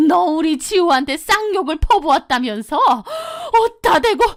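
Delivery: high, shouting mother-in-law, last words dragged; furious mother-in-law. Retold or imitated. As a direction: scold her while jabbing a finger. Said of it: "시어머니가 벌떡 일어나 저를 향해 삿대질을".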